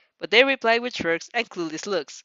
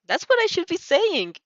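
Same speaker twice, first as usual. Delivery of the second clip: high, lively protest; playful protest; 10 semitones higher, 3 dB louder than usual. Acted. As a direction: protest warmly, pitched up. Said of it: "you argue".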